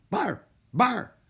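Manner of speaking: high yappy barking; seal bark. Acted. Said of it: "the seals barked".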